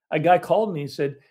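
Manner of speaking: ordinary talk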